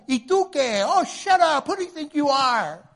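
high, loud indignant snap back; defensive retort. Retold or imitated. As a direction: bristle at a friend's hard truth. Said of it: "You might go".

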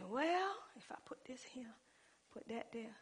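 whispered plotting to herself; scheming whisper. Retold or imitated. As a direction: whisper it like a secret plan.

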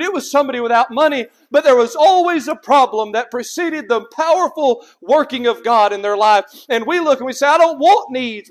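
loud, insistent pulpit voice; forceful preaching. Real, not acted. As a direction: preach it out, punching each item in the list.

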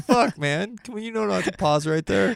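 high-pitched, put-upon protest; mock exasperation, pitched well up; exasperated complaint. Mock-scolding the joke reader.

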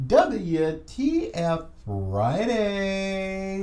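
slow, stretched announcer call; drawn-out announcer. Acted. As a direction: draw out the segment title like a show host. Announcing the weekly segment name.

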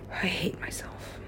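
slow, barely voiced murmur; despairing whisper. Real, not acted. Speaker alone, wanting out of this body, despairing whisper. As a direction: let it drop to a slow, unvoiced whisper.

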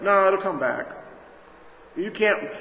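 usual speaking voice

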